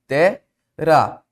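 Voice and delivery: "terá" in two separated syllables; split into syllables. Shown as a demonstration. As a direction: break "terá" as te, pause, rá.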